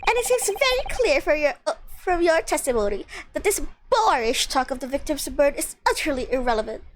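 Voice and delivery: hammed-up courtly gentleman; fawning courtroom gentleman. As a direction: gush over the lady, drawing out the opening. Plays a courtroom figure flattering the lady.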